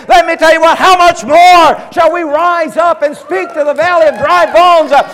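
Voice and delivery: high, loud revival preaching; fiery preaching. Real, not acted. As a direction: cry it out urgently to rouse the congregation. Preaching at full force.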